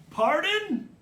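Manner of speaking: high, loud query; yelled question. A narrator's reading. Brother keeping up his deafness routine, yelling. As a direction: shout it as if unable to hear.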